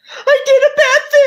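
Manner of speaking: put-on childlike crying confession. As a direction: blurt it out in tears like a child. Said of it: "like, crying and".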